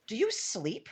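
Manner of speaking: shocked. Acted. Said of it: "shocked, a little scared".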